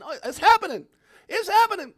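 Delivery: high, loud thrilled announcement; excited hype. Real, not acted. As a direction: shout it like the moment has come. Hyped about XRP news.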